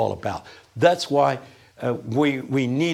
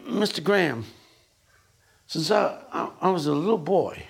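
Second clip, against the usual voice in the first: halting, timid sharecropper voice; imitating a hesitant, simple country speaker; John Perkins impression; 3 semitones higher than usual. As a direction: stumble into a humble confession. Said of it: "he talks just like this".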